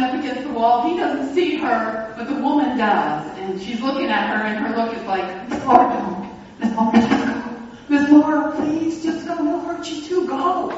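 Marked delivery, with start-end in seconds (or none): 5.5-10.7 urgent high pleading whisper-plea. Retold or imitated. Beaten woman begs pastor to flee, high urgent plea. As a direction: beg her to leave, higher and louder. Victim's pleading look, voiced.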